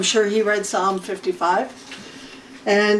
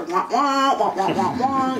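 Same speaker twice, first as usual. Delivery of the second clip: muffled cartoon-adult "wah wah" drone; mock droning wah-wah voice; Charlie Brown's teacher. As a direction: imitate unintelligible grown-up babble in wordless wahs. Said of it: "like a Charlie Brown, the teacher".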